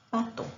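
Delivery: pato, dropping the za of zapato; child's mispronunciation. Shown as a demonstration. Teacher showing a child's dropped syllable, clipped pato. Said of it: "el niño quiere decir zapato".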